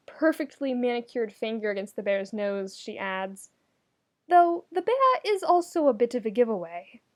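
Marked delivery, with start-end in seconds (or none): 4.3-7.0 high, gentle royal voice; Allura, knowing. Acted. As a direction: point it out kindly.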